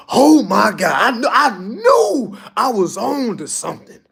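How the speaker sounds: overwhelmed with excitement, slowing down; excited. Real, not acted. Recalling thrilling moment at event.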